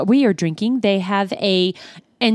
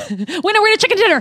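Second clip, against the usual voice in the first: excited; 9 semitones higher, faster than usual. Real, not acted. Celebrating a right answer.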